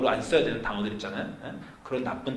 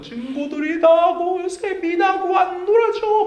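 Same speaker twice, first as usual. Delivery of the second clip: high, slow, wailing child imitation; crying child; 7 dB louder, slower than usual. Father imitating his crying son, high and wailing. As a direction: whine it out like a sobbing little boy. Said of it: "막 이렇게 이제 막 울기 시작한 거예요".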